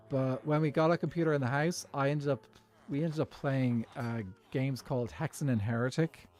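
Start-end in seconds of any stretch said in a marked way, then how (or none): none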